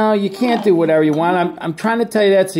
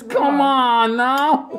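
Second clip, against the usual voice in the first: laughing; 6 semitones higher, much slower than usual. Real, not acted. Laughing at toddler at table.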